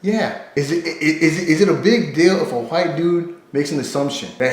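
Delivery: ordinary talk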